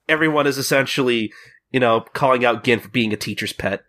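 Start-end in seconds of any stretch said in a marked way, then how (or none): none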